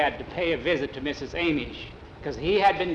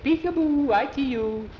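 high singsong taunt; childlike, gleeful singsong; playful peekaboo; 10 semitones higher than usual. A narrator's reading. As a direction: sing it out high and teasing. Skeeter teases the peeking woman.

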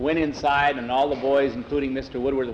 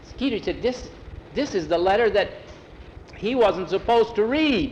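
put-on upset boy; 8 semitones higher, 3 dB louder than usual. Boy blurts out the letter.

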